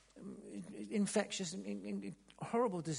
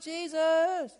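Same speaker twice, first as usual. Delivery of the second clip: high loud call of a name; shouting out; 11 semitones higher, 6 dB louder than usual. Acted. Lepers at a distance calling Jesus, high shout. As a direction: call his name from far away. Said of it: "This is why they were shouting out".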